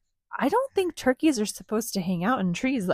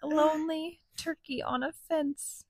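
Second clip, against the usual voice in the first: sad whisper; 6 semitones higher, 6 dB quieter than usual. Acted. Sad moment in story.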